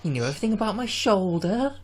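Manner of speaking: put-on Timothy Dalton Welsh accent; Welsh-accented Bond impression. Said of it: "Tim Dalton there with your Welsh accent".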